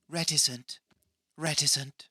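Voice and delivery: lightly mimicked woman's voice, a little high; imitating her pronunciation. Host echoes the way she says it, light high mimicry. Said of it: "I like the way she says it".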